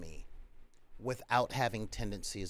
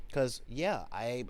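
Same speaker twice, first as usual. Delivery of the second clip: normal talking voice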